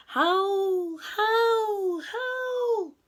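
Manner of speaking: high, loud wind howls, climbing; howling wind. A narrator's reading. Storyteller voices the wind outside, rising howls.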